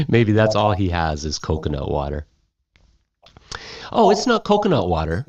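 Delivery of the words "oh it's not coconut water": much higher, quick and sly; high, fast conspiratorial tease; winking tease. Retold or imitated. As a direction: tease with a knowing wink. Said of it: "winks the woman".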